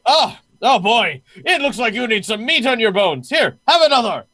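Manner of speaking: high, loud, hearty host voice; jovial guard captain. Acted. Jovial host offers meat to a winded guest, hearty and loud. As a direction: boom it out, pressing food on a guest.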